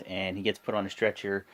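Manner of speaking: usual speaking voice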